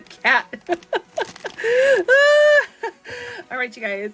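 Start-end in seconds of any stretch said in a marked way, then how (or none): 0.5-3.5 high, loud squealing laugh; excited squealing laughter; shrieking laugh. Real, not acted. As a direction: shriek with delight. Cat figure revealed.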